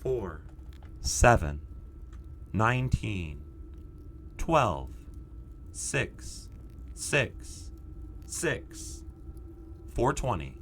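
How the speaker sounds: low, slow, deadpan numbers broadcast; numbers station voice. Pretend. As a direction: read the numbers like a creepy numbers station. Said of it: "I'm just a numbers station".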